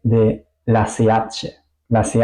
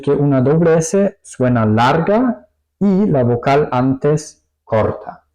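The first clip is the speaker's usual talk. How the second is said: ordinary delivery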